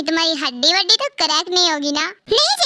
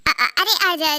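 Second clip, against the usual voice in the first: ordinary talk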